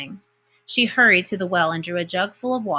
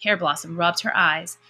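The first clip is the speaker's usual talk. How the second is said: plain reading voice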